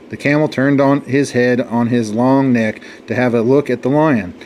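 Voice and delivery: usual speaking voice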